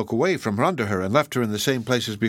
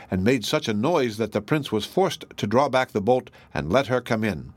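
ordinary delivery

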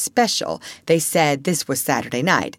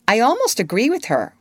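higher-pitched irritated retort; snapping; 6 semitones higher, 3 dB louder, faster than usual. A narrator's reading. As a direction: snap it out, pitch raised. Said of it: "Wendy snapped".

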